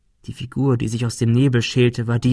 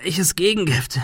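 higher, strained question; in pain; 6 semitones higher than usual. A narrator's reading. Wounded Thomas questions Adrian about the antidote, in pain. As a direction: ask through the pain. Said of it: "fragte er unter Schmerzen".

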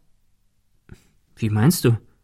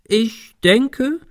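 much higher, hammed-up deliberation; exaggerated; 11 semitones higher than usual. A narrator's reading. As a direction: overplay the thinking, pitched way up. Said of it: "fuhr er übertrieben fort". (übertrieben, gespielt bedächtig)

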